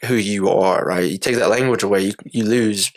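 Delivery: ordinary talk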